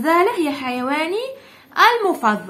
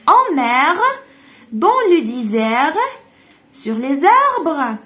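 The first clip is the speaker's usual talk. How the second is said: very slow "dans le désert, sur les arbres."; slow model reading; much slower than usual. Shown as a demonstration. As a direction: read each answer choice syllable by syllable. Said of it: "teacher reads options slowly".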